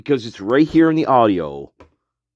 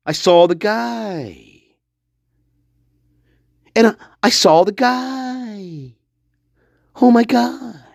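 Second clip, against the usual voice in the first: slow, hammed-up dramatic witness; mock theatrics; 7 semitones higher, slower than usual. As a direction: overact the shocked witness, lightly. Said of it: "goes into her theatrics".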